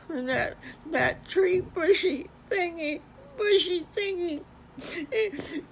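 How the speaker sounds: high, slow, sobbing little wolf; wailing pup, voice breaking and loud; crying wolf. Acted. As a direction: cry it out like a heartbroken little wolf. Wolf tells how his house blew down.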